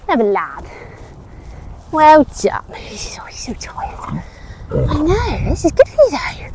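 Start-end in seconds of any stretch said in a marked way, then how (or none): 2.8-4.2 slow whispered aside; whispering. Real, not acted. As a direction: hush it, slowly and fondly. Aside about the horse.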